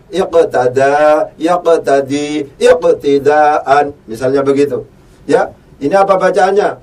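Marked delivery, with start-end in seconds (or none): 0.1-3.9 slow recitation. Shown as a demonstration. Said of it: "Berasal dari fi'il madhi apa?".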